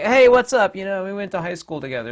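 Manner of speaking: high, loud mock-chummy hail; jokey greeting. Stranger greeting someone like an old schoolmate, loud and chummy. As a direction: hail them like a long-lost school buddy. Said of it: "jokingly gives me a little slug".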